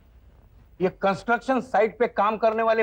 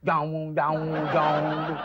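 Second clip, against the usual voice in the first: low, slow munching noise; imitated buffalo chewing; 7 semitones lower, 3 dB quieter, much slower than usual. Boss mocks a gum-chewing worker, imitating buffalo chewing. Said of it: "भैंस की तरह मुंह हिला रहा है".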